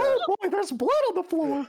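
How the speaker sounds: very high squeaky cartoon mouse; Mickey Mouse voice. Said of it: "my Mickey Mouse voice".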